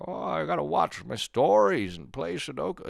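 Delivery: mock elderly voice sliding toward Bane; old person voice. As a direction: play a doddering old woman. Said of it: "my old voice is slipping into Bane".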